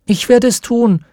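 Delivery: put-on high eager little girl volunteering; little girl calling. A narrator's reading. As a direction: call out eagerly as a small girl. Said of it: "rief ein kleines Mädchen".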